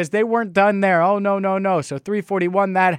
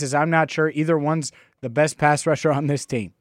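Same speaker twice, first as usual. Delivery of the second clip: normal talking voice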